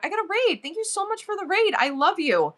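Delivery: high-pitched, overcome thanks; real happy emotion, voice lifted high; happy emotional. Real, not acted. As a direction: gush gratitude with happy emotion. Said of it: "Sorry I'm being emotional".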